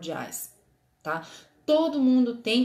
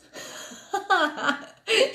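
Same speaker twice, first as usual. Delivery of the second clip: bright burst of laughter; high laugh; 10 semitones higher, 3 dB louder than usual. Real, not acted. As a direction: laugh at your own slip. Laughs at own math slip. (risada aguda)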